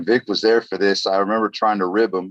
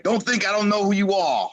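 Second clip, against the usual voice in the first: mock-threatening wrestler impression; Dream; 10 semitones higher than usual. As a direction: imitate a promo man's warning, pitched way up. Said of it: "and be like".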